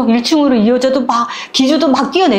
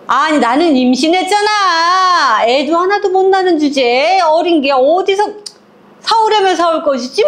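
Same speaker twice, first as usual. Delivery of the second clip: sneering sister-in-law; 7 semitones higher than usual. Retold or imitated. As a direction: talk down to her with scorn. 동서가 형님을 무시하며 말함. (높고 느리게 비아냥거리는 동서)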